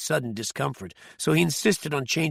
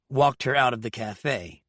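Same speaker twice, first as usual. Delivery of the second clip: ordinary delivery, nothing marked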